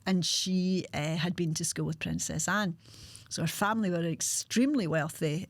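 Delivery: ordinary talk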